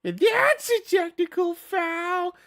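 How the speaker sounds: put-on high, slow, strained old man voice; Whitey voice. Gamer doing Whitey from Eight Crazy Nights, high and slow. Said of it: "That one's, like, really high pitched, the Whitey one".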